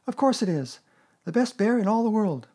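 put-on high, loud, delighted boy; excited praise. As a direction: proclaim it proudly. Christopher Robin praises Pooh excitedly.

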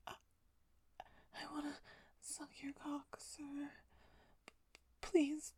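usual speaking voice

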